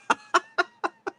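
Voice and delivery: high, wheezy giggle; a second of high-pitched laughing at your own joke; amused laugh. Real, not acted. Streamer cracking up over his own silly card joke, amused laugh.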